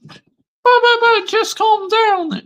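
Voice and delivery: a shrill-pitched taunting fan; squeaky heckler. Acted. Rival fan taunting Joshy J, high squeaky mock voice. Imagined critic telling him calm down.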